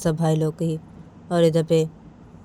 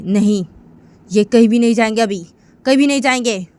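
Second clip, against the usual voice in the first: loud, raised-pitch objection; acted forceful "no"; defiant refusal; 5 semitones higher, 8 dB louder than usual. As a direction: forbid it outright at full voice. Uma firmly refuses, acted quarrel.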